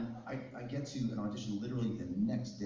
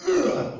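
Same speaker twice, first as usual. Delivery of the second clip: very loud, high effort grunt; explosive fight-scene attack grunt; swipe grunt; 13 dB louder than usual. Shown as a demonstration. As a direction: grunt as if slashing with the arm. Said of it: "every time I would take a swipe".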